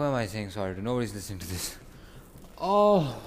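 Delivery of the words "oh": high, loud groan of pain; real anguished moan. Real, not acted.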